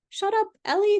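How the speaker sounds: a bossy little voice in her head; inner voice. Retold or imitated. Inner voice telling Ellie to hush, high. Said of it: "that little ding that's like".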